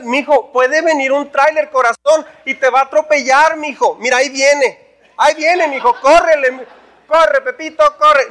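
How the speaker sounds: a too-gentle parent, high, then a loud call; mock-sweet parent. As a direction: coo at the child while the truck comes. Overly sweet parent, truck coming.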